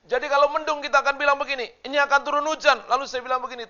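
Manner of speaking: loud, high, ringing sermon voice; full-force preaching. Acted. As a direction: preach it out to the whole church, slowing on the key lines. Khotbah dengan suara penuh. (khotbah berapi-api dengan suara lantang)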